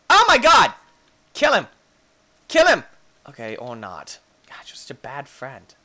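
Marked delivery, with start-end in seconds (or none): 0.1-2.8 loud high cheering yells; excited yelling at the fight; shouting. Real, not acted. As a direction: egg them on at full voice. Dinos fighting, yelling them on.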